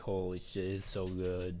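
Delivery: usual speaking voice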